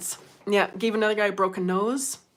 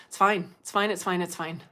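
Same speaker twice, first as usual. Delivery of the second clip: ordinary talk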